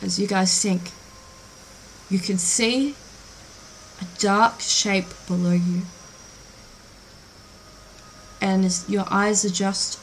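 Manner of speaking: soft, even, immersive storytelling; hushed, measured scene-setting with lingering pauses; game master narration. Acted.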